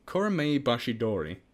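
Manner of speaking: normal talking voice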